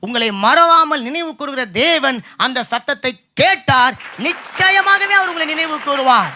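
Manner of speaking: forceful, ringing sermon promise; impassioned preaching. Real, not acted. (உரத்த பிரசங்கக் குரல்)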